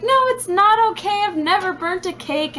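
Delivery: high whiny sobbing protest; hammed-up crying complaint; mock bawling. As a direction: wail it like a tearful kid. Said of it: "And I'm bawling".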